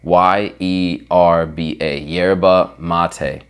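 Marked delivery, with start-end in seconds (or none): 2.0-3.4 YERBA MATE slow, American, y start and "mah-tay"; deliberately Americanized yerba mate, drawn right out; American accent, stretched "YAIR-ba MAH-tay"; American pronunciation. Shown as a demonstration. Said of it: "never hear me say it like that again".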